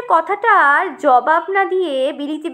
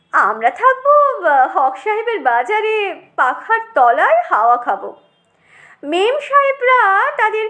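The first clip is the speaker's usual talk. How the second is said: affected sahib with hesitant "ah"s; snobbish potato, aggrieved; 3 semitones higher than usual. Acted. Potato deplores the crowded native market, snobbish. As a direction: complain grandly, lingering over the disdain.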